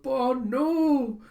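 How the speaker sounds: high, loud mock wail; drawn-out, melodramatic cry of loss; grieving cry. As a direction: wail it in hammed-up grief. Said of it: "kind of grieving over him".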